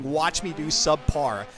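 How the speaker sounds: ordinary talk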